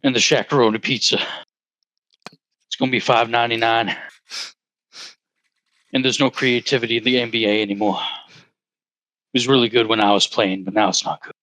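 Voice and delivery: low, fast mumbling Shaq voice; Shaquille O'Neal impression. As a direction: rumble it out low and quick like Shaq. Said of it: "how about you, Shaquille O'Neal?".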